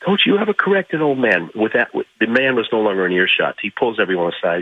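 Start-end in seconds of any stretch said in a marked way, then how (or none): 0.1-1.5 Ali. Retold or imitated. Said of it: "He says".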